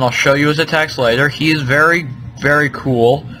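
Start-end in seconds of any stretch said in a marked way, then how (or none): none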